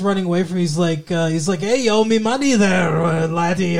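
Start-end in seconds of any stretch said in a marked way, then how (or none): 1.6-3.8 mock Irish money collector; badly faked Irish brogue; wobbly Irish accent. Vampire bookie demanding money from a debtor, mock Irish accent. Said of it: "all over the place with that accent".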